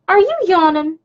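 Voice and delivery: high-pitched pet-talk question; puppy talk. Real, not acted. Owner to yawning puppy, high pet-talk.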